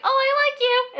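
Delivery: high, bright mock declaration of a crush; sweet confession. Mocking a boy telling a girl he likes her, in a high squeaky voice. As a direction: squeak it out like a shy teen confessing. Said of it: "so he can't really be like".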